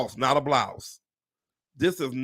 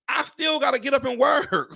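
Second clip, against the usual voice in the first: exasperated shout; 10 semitones higher than usual. Real, not acted. Ranting to viewers about having to keep working, exasperated shout. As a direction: belt it out in disbelief.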